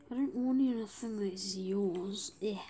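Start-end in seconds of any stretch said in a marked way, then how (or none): none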